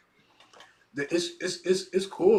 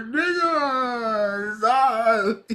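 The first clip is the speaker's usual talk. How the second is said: cocky challenge; 12 semitones higher, 11 dB louder, much slower than usual. Retold or imitated. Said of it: "He's like".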